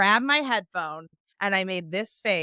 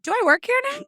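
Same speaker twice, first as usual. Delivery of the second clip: much higher, incredulous mock questions; sarcastic questions; 9 semitones higher, 3 dB louder, faster than usual. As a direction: needle the bartender with exaggerated disbelief.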